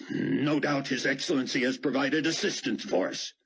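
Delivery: stiff, offended officer barking a threat; lightly voiced indignant commander. Fortunato to Dart, lightly voiced indignant threat.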